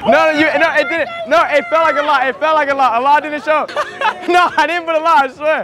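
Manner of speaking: high, loud laughing protest; excited protest. Real, not acted. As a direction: protest it felt like a lot. Hair spray protest.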